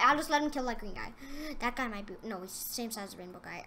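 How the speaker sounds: ordinary talk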